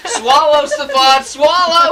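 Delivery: loud laughing shout. Real, not acted. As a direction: yell it through laughter. Friends yelling at choking guy.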